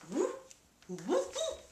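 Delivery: high, goofy whoops; imitated whoop. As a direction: mimic her silly whooping. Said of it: "She started going".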